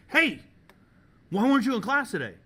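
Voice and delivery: loud, high call-out, quickening; mock-stern shouted demand; imitated teacher's challenge. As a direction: bark it like a teacher stopping a student. Imitating teacher confronting a student.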